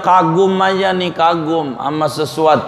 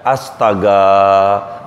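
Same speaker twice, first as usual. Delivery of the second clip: shocked sigh; 8 semitones lower, much slower than usual. Retold or imitated. Acted shock, rubbing chest.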